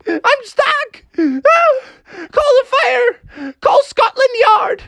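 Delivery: very high, squealed mock wail; crying panic. Froggy cries behind the locked door, panicking. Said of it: "started to cry and panic".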